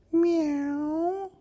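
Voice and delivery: very high meowing; cat meow. Acted. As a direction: yowl like a cat. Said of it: "sang the cats on the wall".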